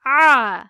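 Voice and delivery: A pressed loud from the throat; throaty A. Shown as a demonstration. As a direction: drive the A from the throat. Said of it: "A harfimiz gırtlak kısmından çıkarılır".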